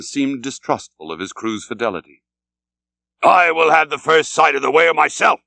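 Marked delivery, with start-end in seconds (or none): 3.2-5.4 louder, higher, fierce resolve; raised declaration. A narrator's reading. Captain vowing to his crew, loud and fierce. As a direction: proclaim it like a vow.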